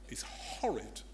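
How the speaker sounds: breathy whisper on a single phrase. Real, not acted. Preacher whispers how horrid betrayal is, hushed. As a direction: drop to a hush. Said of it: "whispered aside on betrayal".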